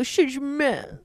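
slow, drawn-out cutesy brush-off; playful, sweetly slurred dismissal; cute and coy. Shown as a demonstration. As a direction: say it cute, stretching it out. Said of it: "but in a cue I go".

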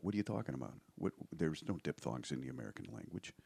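hint of a gruff Chicago guy; lightly voiced Chicago street worker; Chicago accent. Comic imitating Chicago sanitation guys, light Chicago accent. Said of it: "a million guys that talk like this".

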